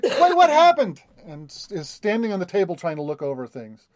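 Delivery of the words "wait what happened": high, slow startled question; suddenly confused and alarmed; surprised. Acted. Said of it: "gets up on the table and is like".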